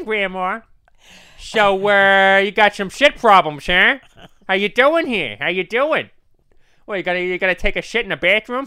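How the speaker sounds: loud, high, brash cartoon dad voice; Peter Griffin impression. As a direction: swagger in as Peter Griffin, booming. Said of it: "I brought my son Peter".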